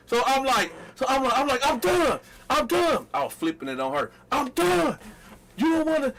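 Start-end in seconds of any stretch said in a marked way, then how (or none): 1.6-6.1 mock heartbroken outrage. To his girlfriend, a hammed-up heartbroken tirade. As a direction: ham up the hurt boyfriend.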